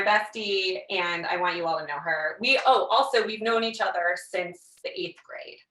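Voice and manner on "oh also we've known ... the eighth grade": ordinary talk, nothing marked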